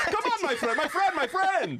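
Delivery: high, slow, badly faked Bangladeshi accent; mock Bangladeshi accent, drawn out. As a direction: plead in a hammed-up Bangladeshi accent. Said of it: "did James Corden become Bangladeshi?".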